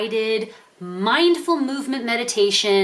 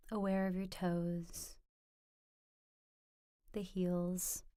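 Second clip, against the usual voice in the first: much softer, slightly lower meditation voice; guided meditation; 4 semitones lower, 16 dB quieter than usual. Acted. Teacher leading viewers into a standing mindful movement practice, soft guided meditation. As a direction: guide them gently, hushed, with long pauses between phrases.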